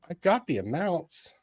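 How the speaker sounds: usual speaking voice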